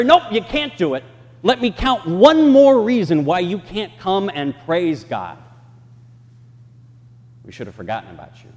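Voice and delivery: stern synagogue leader. Retold or imitated. Voicing synagogue leader's refusal.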